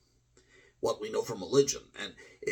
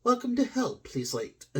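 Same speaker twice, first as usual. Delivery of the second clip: devil clerk greeting, starting high-pitched and falling away; devil retail clerk; 12 semitones higher than usual. Acted. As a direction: greet customers like a devil at a shop counter. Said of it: "devil retail clerk who works at the counter".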